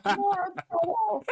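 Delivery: high, slow, disbelieving drawn-out name; incredulous repeat. Real, not acted. Cohost repeats the joke name in stunned delight, high and slow. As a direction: savor the ridiculous name in amused disbelief.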